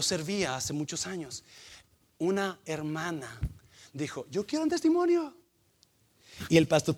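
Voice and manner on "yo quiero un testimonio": high-pitched imitation of a volunteer; eager church sister. Sister asks the pastor to testify, eager high voice. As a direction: pipe up in a high voice.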